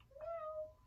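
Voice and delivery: high, soft, tiny mew; kitty meow. Shown as a demonstration. Teacher rounding into kitty pose with kids, high soft meow. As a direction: mew quietly like a kitten. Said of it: "like a little kitty cat".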